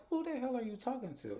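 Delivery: high-pitched indignant comeback, imitating Angela; Angela's high snap. Angela snapping back at Bryce, high and indignant. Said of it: "And Angela's like".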